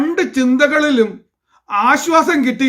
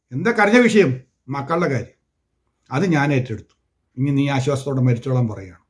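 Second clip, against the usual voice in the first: normal talking voice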